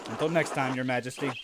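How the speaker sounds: low, understated farewell to a monarch; subdued, deferential goodbye; hero's reply. Acted. Hero answers the royal figure.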